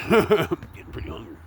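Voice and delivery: laughing. Real, not acted. Repeats line with a laugh.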